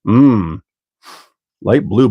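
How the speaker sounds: ordinary talk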